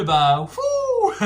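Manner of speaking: bursting into laughter; laughing. Real, not acted. Laughs about the meds.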